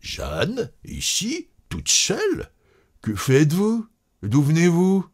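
high, astonished questions; stupefied. A narrator's reading. Cousin finding Jeanne alone in the street, astonished. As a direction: question her in rising amazement. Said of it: "Il s'arrêta stupéfait".

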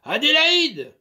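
loud call across the house; master's loud summons. Acted. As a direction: bellow her name to summon her. Master calls the maid.

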